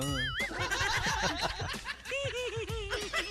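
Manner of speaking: long, high laugh; high giggling laugh. Real, not acted. DJ laughing at the childish senator. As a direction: giggle helplessly.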